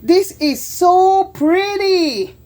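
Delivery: high, slow, thrilled gush; delighted gush. Retold or imitated. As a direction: swoon over the pretty jar. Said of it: "I was saying".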